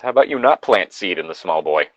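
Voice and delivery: ordinary talk, nothing marked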